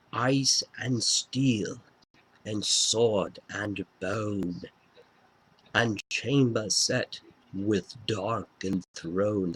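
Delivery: slow, dramatic intoned intro voice; son's slow, grand take on his father's stage voice; imitating my dad. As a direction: draw each word out like an epic spoken intro. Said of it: "I'll try to do my best impersonation or impression of my dad".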